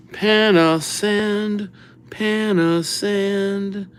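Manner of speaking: Panasand stretched slowly, syllable by syllable; drawn out. Shown as a demonstration. Host sounding out a viewer's name while writing, drawn out. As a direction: draw out Panasand as if spelling it.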